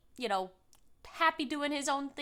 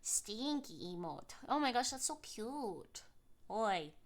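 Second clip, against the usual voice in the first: usual speaking voice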